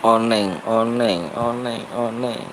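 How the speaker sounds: slow, fading sleepy mumble; drowsy, drifting off mid-word; nodding off. Real, not acted. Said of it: "maaf udah ngantuk begini matanya".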